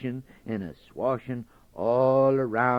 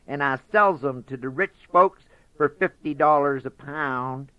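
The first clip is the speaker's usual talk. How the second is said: higher, louder bragging rabbit; boastful Brer Rabbit; 6 semitones higher, 7 dB louder than usual. Acted. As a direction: sell the tall tale proudly.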